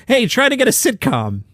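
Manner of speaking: high-pitched, pushy suggestion; mock eager advice. Host quoting a veteran comic's career tip, mock eager. Acts out more outdated advice.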